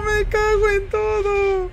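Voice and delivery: muttered curse. Real, not acted. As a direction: grumble it the second time. Repeats the curse, fading.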